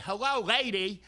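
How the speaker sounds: calling out. Retold or imitated. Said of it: "and I'd say".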